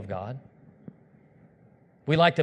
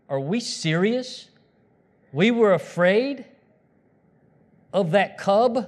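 slow, halting incredulous question; spaced-out phrases, each one disbelieving; scornful disbelief; 3 semitones higher, slower than usual. Acted. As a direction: belittle the threat in mock amazement. Acts scornful disbelief on Judgment Day.

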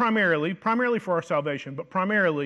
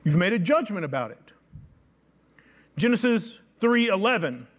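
ordinary delivery

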